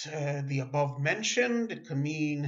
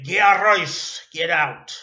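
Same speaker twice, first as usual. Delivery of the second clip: ordering out; 12 dB louder than usual. Shown as a demonstration. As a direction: throw them out of your house. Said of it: "If you want to tell somebody".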